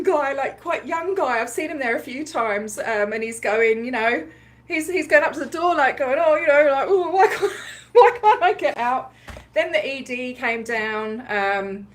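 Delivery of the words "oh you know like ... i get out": mock plea broken by laughter; laughing quote. Imitating the trapped guy, laughing mid-quote. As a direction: laugh through his plea.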